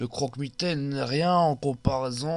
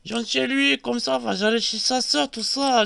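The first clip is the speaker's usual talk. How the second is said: excited gossip voice; 7 semitones higher than usual. Acted. Jojo telling Johnny about little Nicklé, high and excited. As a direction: squeal out the shocking news. Jojo's voiced story continues.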